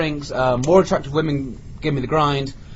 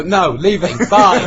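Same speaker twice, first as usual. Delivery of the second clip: louder, abrupt walk-out; brusque mock refusal; 4 semitones higher, 7 dB louder, slower than usual. Customer hears room price, loud mock refusal. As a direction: refuse loudly and be gone.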